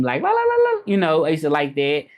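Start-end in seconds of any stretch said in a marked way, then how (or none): 0.2-0.8 high ululating battle yell; Xena war cry. Retold or imitated. Vlogger imitating Xena's war cry on TV, high ululating yell. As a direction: trill it like a warrior queen. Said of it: "the way she used to scream".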